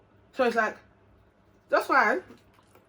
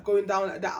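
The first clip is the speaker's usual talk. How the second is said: ordinary delivery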